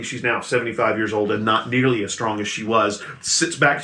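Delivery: usual speaking voice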